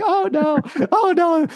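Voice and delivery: high, playful retold panic; imitating his own startled reaction, lightly; mock alarm. Retold alarm at police news.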